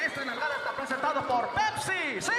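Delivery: punchy mock DJ sponsor announcement; radio sponsor plug. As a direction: read the sponsor line like a hyped host. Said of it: "talking like that to his wife".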